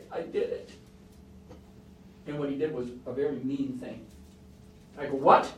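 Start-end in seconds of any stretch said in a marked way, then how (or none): 0.1-0.7 tearful confession. Retold or imitated. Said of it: "Tears are coming down his eyes". 5.2-5.5 loud startled what; sudden loud disbelief; shocked what. Retold or imitated. Stunned at child's confession.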